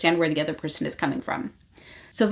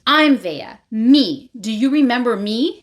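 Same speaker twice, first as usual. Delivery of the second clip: loud, higher insistent demand; storming back; 3 semitones higher, 5 dB louder than usual. Retold or imitated. Vea confronting Rave, insistent. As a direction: insist on being remembered, voice raised. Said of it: "she storms back and she goes".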